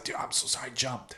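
hushed, half-whispered apology; retold apology. Apologising to the man he spilled a drink on, hushed apology.

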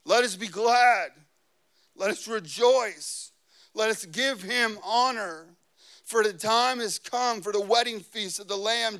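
rally the congregation phrase by phrase; ringing preacher's voice; rousing scripture proclamation. Real, not acted. Proclaims scripture at full force.

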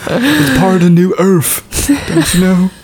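put-on Creed accent. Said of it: "Where are you from, Creedland?".

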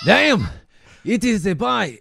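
loud, high-pitched hammed-up Scottish accent; attempted Scottish accent. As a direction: burst out in a rough mock Scottish accent. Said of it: "you have to have a Scottish accent".

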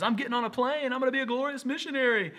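high, singsong glorious-missionary daydream; naive excitement. Acted. Pastor mocking his missionary dreams, high-pitched naive glee. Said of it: "me thinking walking through something is".

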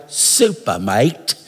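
put-on Australian accent, dropped low; mock Australian "super, mate". Shown as a demonstration. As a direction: say it like an Australian bloke. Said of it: "people from Australia say that word".

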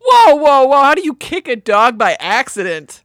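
loud, high whoa of alarm; shocked objection. Real, not acted. Shocked cohost objects to kicking a dog, loud. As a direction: stop him in his tracks, loud.

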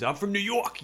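half-hearted New York accent impression; badly faked New York accent. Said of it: "I can't even mimic their accent".

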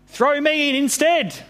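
high-pitched call to the sailors; acted shout. A narrator's reading. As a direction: call it out high, over a storm. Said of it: "he shouted to the sailors".

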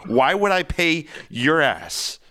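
furious customer telling him off; angry. Acted. Angry argument with gym caller.

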